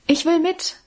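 put-on high-pitched eager child's call. A narrator's reading. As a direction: pipe up as a little girl wanting to come. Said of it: "rief da Alwine".

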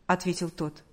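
plain reading voice

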